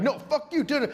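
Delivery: very high, squabbling comeback without real volume; mock yelling back. Two coworkers fighting over a sandwich, mock yelling back. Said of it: "they kinda start yelling back".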